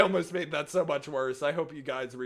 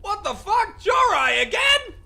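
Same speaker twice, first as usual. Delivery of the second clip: loud, high surprised yelling; excited shout; 10 dB louder than usual. Real, not acted. Streamer greets a friend arriving again, excited shout. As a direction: shout with delight at a returning friend.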